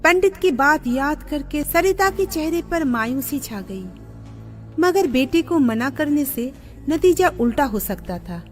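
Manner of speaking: usual speaking voice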